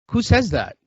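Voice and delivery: who on earth says that, amazed; incredulous. Real, not acted. Incredulous at friend's excuse.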